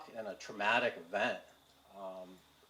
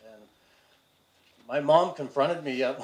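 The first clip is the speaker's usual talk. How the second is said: ordinary talk, nothing marked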